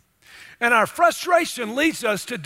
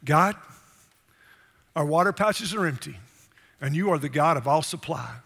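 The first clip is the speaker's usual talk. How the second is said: lower, gentle prayer to God; calm, lowered, reverent voice; humble prayer; 4 semitones lower than usual. Acted. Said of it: "They could have stopped and said".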